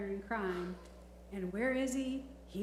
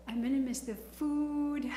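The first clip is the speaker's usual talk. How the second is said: drawn-out, higher-pitched mock lament; playful lament; 5 semitones higher, 4 dB louder than usual. Real, not acted. Trustee lamenting the food she will miss, playful lament. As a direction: bemoan it, stretching out the words.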